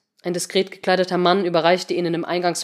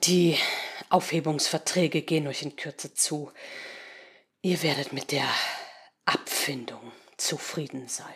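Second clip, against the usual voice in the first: menacingly quiet, lower and softer; low looming threat; 4 semitones lower, 7 dB quieter than usual. Acted. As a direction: threaten softly, dropping low. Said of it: "fuhr dann bedrohlich leise fort".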